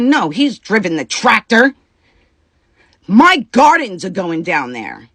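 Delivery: high, loud mock snap; mocking the wife. Retelling the wife's refusal, a loud, high mocking imitation. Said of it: "And she says".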